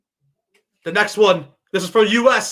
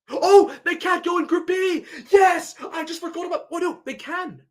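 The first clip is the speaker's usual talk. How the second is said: excited realisation; 9 semitones higher than usual. Real, not acted. Realises draw rule excitedly.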